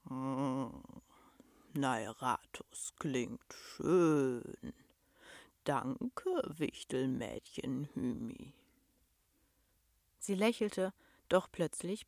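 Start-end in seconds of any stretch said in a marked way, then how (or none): 0.1-4.7 low, very slow, pleased monster hum; contented rumble. A narrator's reading. Monster tries out its new name, contented deep rumble. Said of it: "gab ein zufriedenes Brummen von sich". 5.6-8.5 slow beast voice sinking low; grateful monster. A narrator's reading. Monster thanks the girl for its name, deep slow rumble.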